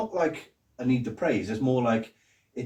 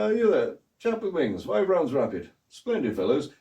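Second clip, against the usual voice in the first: posh officer's voice, pitched up; the Brigadier; 5 semitones higher, 3 dB louder than usual. Acted. Officer ordering his men about, posh commanding voice. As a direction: call out orders like a posh army man. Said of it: "just me doing a posh voice".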